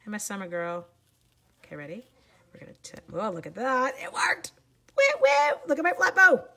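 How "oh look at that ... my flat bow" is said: loud, high excited whooping; super excited. Real, not acted. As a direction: burst out cheering over a small success. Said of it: "I get super excited".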